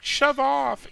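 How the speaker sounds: a half-attempted Aussie snap; hammed-up Australian accent. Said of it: "but it's an Australian accent".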